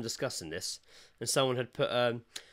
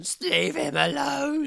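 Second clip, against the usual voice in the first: high, slow, squeaky shout of just leave him alone; mock hero voice; 14 semitones higher, 4 dB louder, much slower than usual. Shop worker's defender, hammed-up squeaky warning. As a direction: play an avenging stranger in a silly voice. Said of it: "Just run in".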